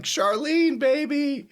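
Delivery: very high, loud hammed-up cry; bird's lovesick call. Flappy calling to Charlene, high lovesick cry. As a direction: cry out to a lost sweetheart. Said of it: "I'm like".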